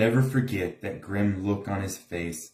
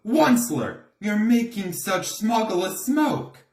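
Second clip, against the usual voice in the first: croaky complaint; 11 semitones higher than usual. A narrator's reading. The Lorax scolds the Onceler for his smog, higher and croaky. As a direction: cry out the name, then scold the smoke. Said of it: "he cried with a cruffulous croak".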